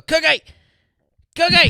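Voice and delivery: high, loud holler after a runaway dog; imitated dog-calling yell. Worker imitating boss chasing his little dog, high mock holler. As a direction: holler the pet's name, hammed up. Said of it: "and he'd go".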